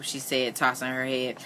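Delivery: ordinary delivery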